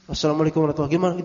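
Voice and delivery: very fast, clipped repeat of the salam; hurried repeat of the message greeting; rattled-off greeting. Retold or imitated. Repeating the same WhatsApp greeting.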